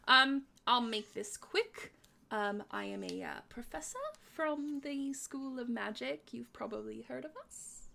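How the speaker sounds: soft, tentative voice in the head; awkward, polite professor introducing himself; hesitant professor. Acted. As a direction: speak gently and haltingly, unsure of your welcome. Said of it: "you hear a voice in your head".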